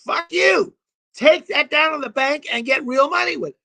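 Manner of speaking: wife ordering her husband to the bank, furious; angry wife. Retold or imitated. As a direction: spit it at him like a fed-up wife.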